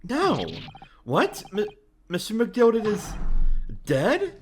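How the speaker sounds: high, stammering disbelief; acted shock. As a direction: gasp out the news as a startled question. Acts shock at news of death.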